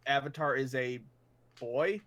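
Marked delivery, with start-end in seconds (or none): none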